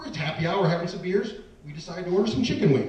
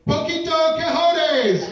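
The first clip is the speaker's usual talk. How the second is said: high, drawn-out bartender's holler; hollered greeting; 9 semitones higher, 4 dB louder, much slower than usual. Retold or imitated. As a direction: call it out like a rowdy bartender.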